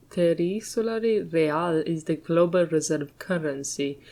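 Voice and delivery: ordinary talk, nothing marked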